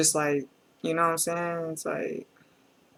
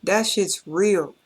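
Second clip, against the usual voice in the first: slow emphatic; 3 semitones higher, much slower than usual. Real, not acted. Stunned by raw lyrics.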